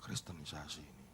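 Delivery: low muttered suspicion; very quiet, low imitated grumble; suspicious onlooker. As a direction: murmur it under the breath like a bystander. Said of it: "Kayaknya begitu kan".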